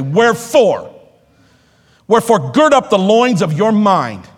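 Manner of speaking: "Wherefore" rung out as a statement, then "gird up the loins of your mind" punched; "gird up the loins of your mind" declared forcefully, each stressed word driven home; emphatic. Retold or imitated. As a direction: turn on the heat on "Wherefore" and "gird". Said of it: "It was emphatic. It was a statement".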